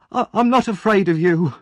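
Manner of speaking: high, loud stammering defiance; defiant boy. Acted. Oliver shouts defiance at Mister Bumble from the cellar. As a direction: stand up to him, voice catching.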